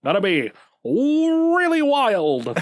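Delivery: put-on slow, high transatlantic accent, old Hollywood style; drawn-out transatlantic accent like a glamorous film star. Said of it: "we'll pull out the transatlantic accent again".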